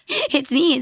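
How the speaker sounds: high giggly laugh. Real, not acted. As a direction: laugh through the words. Laughs at being recognized.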